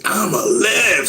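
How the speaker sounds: very high, drawn-out re-enacted shout; frantic high yell, acting out the moment; saying out loud. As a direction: cry it out, desperate to be heard. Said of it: "saying out loud".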